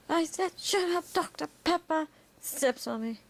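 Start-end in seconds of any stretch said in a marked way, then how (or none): 0.1-2.1 high-pitched impatient character voice; Great One. Acted. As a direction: insist in a pitched-up voice. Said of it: "He goes".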